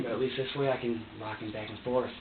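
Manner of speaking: usual speaking voice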